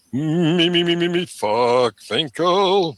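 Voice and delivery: high, loud mocking "me me me"; mocking whiny voice. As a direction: whine like a greedy child. Mocking a self-centered troll.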